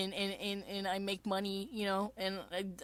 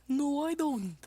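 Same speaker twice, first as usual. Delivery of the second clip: put-on a bit higher, offhand boy voice; lightly voiced Pico; 7 semitones higher, slower than usual. Pico denying he cares, lightly voiced. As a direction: deny it like a bored Vocaloid. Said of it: "and it's Pico".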